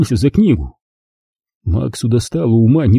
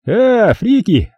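slow, high mocking greeting; drawling Chad; 10 semitones higher, much slower than usual. A narrator's reading. Chad greeting the Freaks, slow and mocking. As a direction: stretch the greeting out to mock them.

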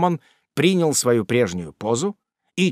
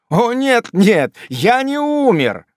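higher, louder, slower earnest cry; eager exclamation; 9 semitones higher, 5 dB louder, slower than usual. A narrator's reading. Tupman cries out that he is alive. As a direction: cry out to reassure her. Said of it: "воскликнул мистер Топман".

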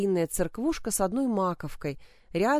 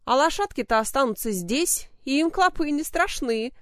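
mocking; 7 semitones higher, 4 dB louder than usual. A narrator's reading. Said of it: "насмешливо пояснил он".

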